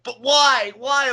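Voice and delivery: high, loud pleading whine; whiny fan. Retold or imitated. Fan denied a high five by Alexa, high whiny pleading. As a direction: beg like a rejected fan. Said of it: "and he's like".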